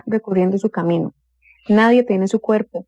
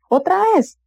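much higher, whining "Otra vez"; imitated whiny complaint; 9 semitones higher than usual. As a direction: mimic someone moaning at the dinner table. Mother imitates complaining family member.

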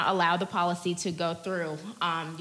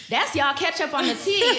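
high and loud, laughing it out; giggling sign-off; 9 semitones higher, 7 dB louder than usual. Real, not acted. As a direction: laugh as you wrap up the gossip. Wrapping up gossip segment, laughing.

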